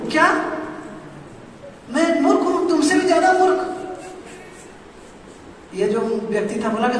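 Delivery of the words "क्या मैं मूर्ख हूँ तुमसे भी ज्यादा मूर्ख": higher, indignant outburst; a king insulted by being called a fool; irritated king. Acted. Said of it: "राजा बिल्कुल चिढ़ गया". (चिढ़े हुए राजा की ऊँची आवाज़)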